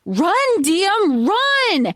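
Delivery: urgent call. Retold or imitated. Mother warns Diem to flee.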